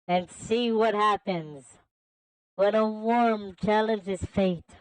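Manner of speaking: slow, drawn-out villain taunt; leisurely villain challenge, rising then falling. Acted. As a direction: goad the challenger, stretching each phrase. Voices the game's villain.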